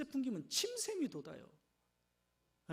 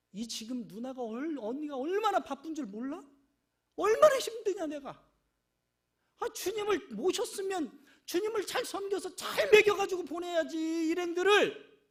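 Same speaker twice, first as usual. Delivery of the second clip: loud, high, whiny angry complaint; Martha's complaint; 6 semitones higher, 10 dB louder than usual. Retold or imitated. Martha complaining to Mary about the work, loud and high. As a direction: vent how overworked you are. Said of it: "막 화가 나는 거요".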